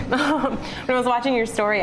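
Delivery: laughing through the words. Real, not acted. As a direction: giggle while getting words out. Laughs at own full mouth.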